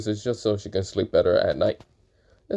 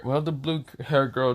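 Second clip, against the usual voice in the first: plain reading voice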